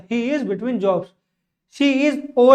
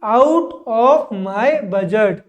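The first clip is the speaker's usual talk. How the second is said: slowed teaching delivery; much slower than usual. Shown as a demonstration. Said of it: "am या it's currently".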